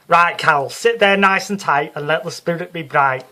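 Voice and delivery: loud, high Liverpool showwoman hushing the hall; Psychic Sue on stage. Acted. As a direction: work the crowd like a brassy Scouse medium. Sue seats Carol for reading.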